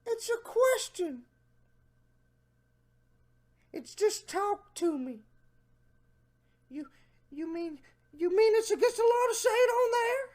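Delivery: high, put-on nerdy guy voice; mock the man in a high whiny pitch; Jeff Stacy voice. Mocking the predator, high put-on voice. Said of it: "I'm gonna try to get in my Jeff Stacy voice".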